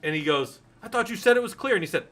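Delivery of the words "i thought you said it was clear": high, loud quoted complaint; mock alarmed protest. Movie character protesting after the alien comes out, mock alarm. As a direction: imitate an indignant outburst, pitched way up.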